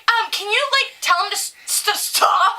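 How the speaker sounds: high, loud, halting request; flustered woman. Retold or imitated. Woman asking mom to stop the kid, high and flustered. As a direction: stammer a high, uneasy plea. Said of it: "like, she's like".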